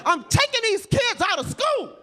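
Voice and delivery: high-pitched, mock-bossy demand. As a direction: play up a brazen order to hand over. Acts out demanding kids at school.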